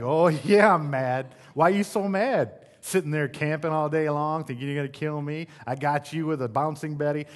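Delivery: loud, heated retort with a laugh at the start; mock furious comeback. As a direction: fire back hot at the gamer in the headset. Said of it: "And I'm like".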